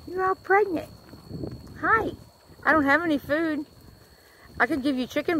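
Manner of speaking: high, soft cooing. Real, not acted. Talking sweetly to pregnant cows, high soft coo. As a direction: coo to them like babies.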